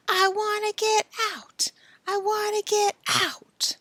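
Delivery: high, half-whispered plea; faint plea. Acted. Billy pleading from the closet, high and faint. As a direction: call faintly from inside.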